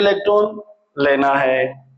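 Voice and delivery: low, slow counter-voice; acted reply. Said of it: "और ये कहती है". (धीमा, नीचे सुर का जवाब)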